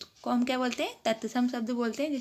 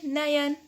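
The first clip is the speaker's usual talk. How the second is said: नयन said higher and louder as one word; emphatic answer; 4 semitones higher, 4 dB louder, much slower than usual. Shown as a demonstration. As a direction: call out नयन for the class. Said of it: "तीनों के एक साथ पढ़ेंगे तो क्या पढ़ेंगे". (नयन को ज़ोर से एक साथ पढ़ना)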